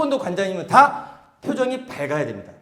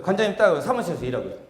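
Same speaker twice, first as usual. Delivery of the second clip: ordinary delivery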